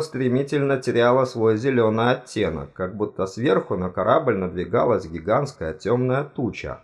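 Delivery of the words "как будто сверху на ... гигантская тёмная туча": usual speaking voice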